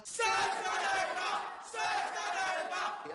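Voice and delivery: mocking kids yelling in the corridor; kids shouting. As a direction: bellow it down the corridor like a gleeful teenager. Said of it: "I've got, like, kids".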